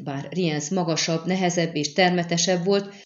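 ordinary delivery, nothing marked

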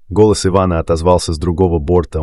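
ordinary delivery, nothing marked

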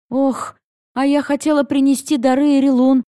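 a worried "oh", then a fretful admission; flustered alarm. A narrator's reading. Heroine remembers her gifts for the goddess, mildly flustered. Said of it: "всполошилась я".

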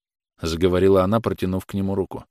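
plain reading voice

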